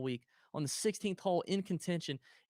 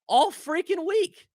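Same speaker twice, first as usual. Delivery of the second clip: rising higher and louder in disbelief; incredulous rant; 7 semitones higher, 6 dB louder than usual. Real, not acted. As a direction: build into an outraged, laughing rant. Ranting about the lucky putting.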